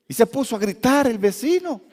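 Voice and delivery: ordinary talk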